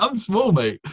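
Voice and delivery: high-pitched incredulous re-enactment; acted-out baffled denial; mock protest. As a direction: protest it in amused disbelief. Acts out own protest, still incredulous.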